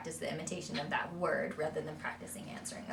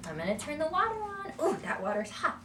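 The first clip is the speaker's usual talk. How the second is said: high, sing-song narrating voice; speech therapist modeling for a toddler; adult narrating to child; 11 semitones higher than usual. Shown as a demonstration. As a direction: talk a child through the bath. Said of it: "use more of the adult phrases like".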